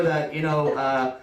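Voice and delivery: normal talking voice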